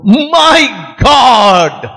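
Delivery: high, drawn-out exclamation; pained outcry. Acted. Man hit on the head by a stone, startled cry. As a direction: cry out in acted shock.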